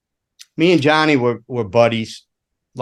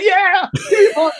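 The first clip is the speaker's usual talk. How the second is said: eager yes. Retold or imitated. Frazzled roadie accepting a bump, high eager yes. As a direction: snap it up eagerly, pitched high. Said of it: "I'm like".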